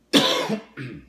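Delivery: a sudden loud cough. Real, not acted. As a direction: cough hard once. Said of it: "I'm coughing and sniffing".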